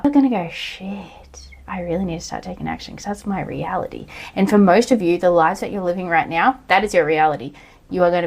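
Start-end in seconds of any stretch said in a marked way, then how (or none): 0.5-4.1 hushed, breathy whisper of dawning panic; whispered realisation. Pretend. Future self whispering to herself in sudden alarm. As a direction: whisper it like a scary secret you just realised.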